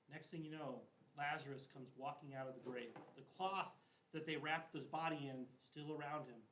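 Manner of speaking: ordinary talk, nothing marked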